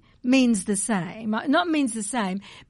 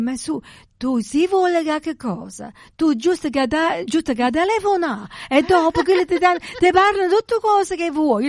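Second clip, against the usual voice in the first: put-on Alida's Neapolitan accent, higher and louder; imitating Neapolitan radio talk, high and loud; 7 semitones higher, 5 dB louder than usual. Quoting the radio host in thick Neapolitan accent. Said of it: "the whole accent is just amazing".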